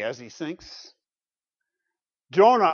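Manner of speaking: normal talking voice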